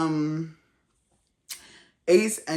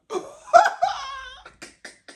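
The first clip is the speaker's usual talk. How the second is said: a loud, high shriek of a laugh; 7 dB louder than usual. Real, not acted. Vlogger cracking up at his shocked face, shrieking laugh. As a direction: burst out in a squealing laugh.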